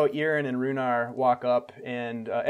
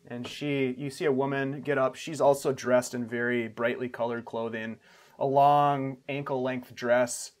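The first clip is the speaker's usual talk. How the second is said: ordinary delivery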